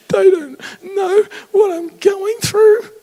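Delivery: very high, crying wail of self-pity; whiny self-pity. Retold or imitated. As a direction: play the ego as a sobbing victim. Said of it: "Try and tell me that your spirit self talks like that".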